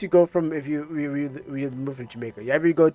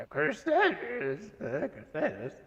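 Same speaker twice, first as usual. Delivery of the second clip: matching a character voice; 9 semitones higher, 8 dB quieter, slower than usual. Acted. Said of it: "let me see if I can match you".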